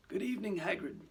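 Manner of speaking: a lightly voiced, composed greeting; composed old headmaster. Dumbledore greeting Hagrid at his door, composed.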